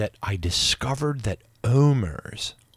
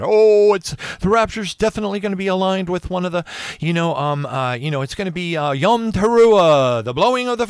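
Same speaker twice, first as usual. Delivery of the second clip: excited; 8 semitones higher, 6 dB louder than usual. Retold or imitated. Relaying others' excited claim.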